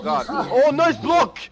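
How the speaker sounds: loud, high, thrilled yell; excited shout. Real, not acted. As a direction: cheer the big play. Teammate blocks an ult.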